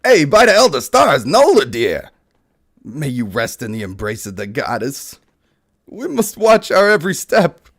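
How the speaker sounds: elf voice. Acted. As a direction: cry out over the fallen comrade. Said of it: "elf mourning the fallen ranger".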